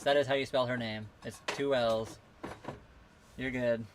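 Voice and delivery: ordinary delivery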